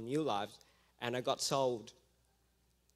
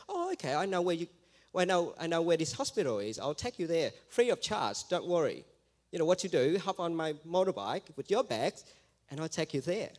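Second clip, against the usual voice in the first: louder, slightly higher acted stranger; mildly eager offer; 4 semitones higher, 6 dB louder than usual. Biker offering the lady a lift to the hospital, mock eager. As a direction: imitate a helpful stranger pressing an offer.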